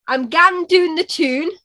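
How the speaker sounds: slow, high Geordie demo; thick Geordie, slowly, for a learner to copy; Geordie accent. Shown as a demonstration. Teaching the American a phrase in Geordie accent. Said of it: "terrible at my own accent".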